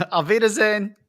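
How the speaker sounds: playful put-on German voice; German accent. Host bids a joking farewell in a German accent. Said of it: "Don't make me do a German one".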